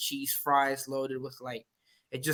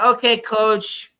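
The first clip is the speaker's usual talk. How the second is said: put-on higher, slow sweet little kid; 7 semitones higher, 5 dB louder, much slower than usual. Ref imitating the young player, slow sweet kid voice. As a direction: answer slow and sweet like a small boy. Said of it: "He's like".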